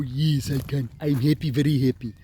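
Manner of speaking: rough imitation of an African accent, pitch barely moved; mock African accent, light and cheerful. A man mocking a comedian's put-on African accent, lightly. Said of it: "an African accent".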